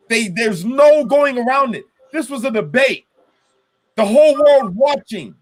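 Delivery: venting real anger at a missed chance. Real, not acted. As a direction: let the anger climb as you vent. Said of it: "That's why I'm fucking mad".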